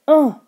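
loud, high creature grunt; monster cry. A narrator's reading. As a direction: cry out a short, forceful ugh. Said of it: "the monster cried again".

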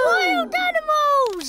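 high alarmed shout; frightened shout. Acted. Children scared by the howl.

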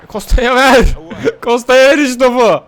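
urging a pass to Costa, excited; excited shout. Real, not acted. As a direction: yell at the screen, then break into a laugh. Urging a pass during play.

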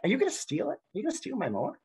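high-pitched incredulous question; mock suspicion. Man asking his new acquaintance about the mower, mock suspicious. Voicing the man's wary question.

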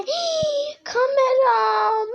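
high gasp, then a slow wail over the camera; gasp of horror. Acted. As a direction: gasp and moan in dismay. Sister finds camera ruined.

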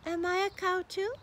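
put-on high, hopeful childlike question; hopeful little truckling. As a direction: ask it wishing the answer is yes. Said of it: "said the cow".

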